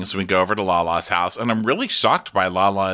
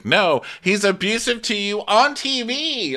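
much higher, slow put-on voice; mock family member; 12 semitones higher, slower than usual. Mimicking her family warning her off him, slow high voice. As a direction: say it like a prim parent objecting. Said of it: "not to say".

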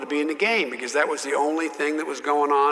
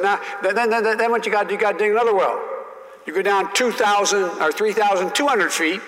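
higher, louder mock Southern senator; folksy senator explaining; 6 semitones higher, 4 dB louder, faster than usual. Senator lecturing the young colleague on oil wells, hammed-up. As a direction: patiently explain like an old senator. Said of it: "He said".

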